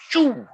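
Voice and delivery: sharp high whooshing sound effect; a quick zooming swoosh. Retold or imitated. Hyperspeed jump whoosh sound.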